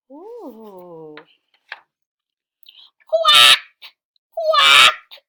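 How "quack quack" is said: very loud, high quack; duck quacking. Acted. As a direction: squawk it out like a duck. Animal noises in read story.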